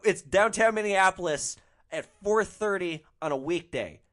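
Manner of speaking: acting out fed-up explaining to a passenger; exasperated retort. Exasperated driver answers complaining passenger.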